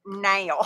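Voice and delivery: "now" bent toward "nayl"; imitated quirky now. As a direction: mimic her "now", rhyming it with "nail". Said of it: "she also says".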